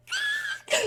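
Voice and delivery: sharp high giddy gasp. Retold or imitated. Reliving fangirling in bed, a high gasp. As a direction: gasp like you can't contain it.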